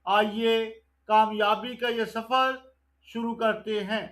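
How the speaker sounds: ordinary talk, nothing marked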